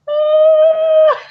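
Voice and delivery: loud, high-pitched drawn-out "ah" of tension; high held squeal. Retold or imitated. Face painter reacting to demand for freebie, high held squeal. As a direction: let out a long mock squeal. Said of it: "and me going".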